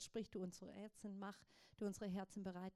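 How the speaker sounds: normal talking voice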